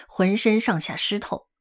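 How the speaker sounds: usual speaking voice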